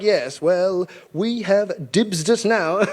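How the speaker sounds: slow, measured British brush-off; mock-British, slow and dismissive; smug British official. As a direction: play an unbothered colonial official, drawn out. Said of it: "the British are like".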